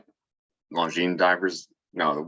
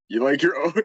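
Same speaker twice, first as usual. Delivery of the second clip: laughing; 8 semitones higher than usual. Real, not acted. Joke line, breaks into laughter.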